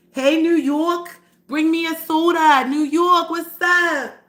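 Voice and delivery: loud, high, drawn-out New York accent. Shown as a demonstration. Hailing New York listeners, playful New York accent. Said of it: "I can do y'all accent".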